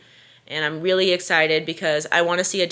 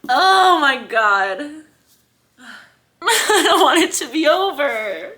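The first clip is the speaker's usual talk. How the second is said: slow, higher, laughing through tears; laugh-crying; 7 semitones higher, 4 dB louder, slower than usual. Real, not acted. Reader not wanting the book to end, laugh-crying. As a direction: laugh and sob at once.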